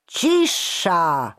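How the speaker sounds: commander's booming order; thundering. A narrator's reading. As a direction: thunder at the crowd to hush. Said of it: "zagrzmiał dowódca straży".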